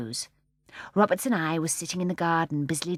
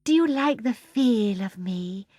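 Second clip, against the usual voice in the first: slow, higher, pleased purr; basking in praise; 4 semitones higher, 5 dB louder, slower than usual. A narrator's reading. The sunlight to Barbara, slowly basking in her praise. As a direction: draw it out, loving the praise. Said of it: "as though it loved being praised".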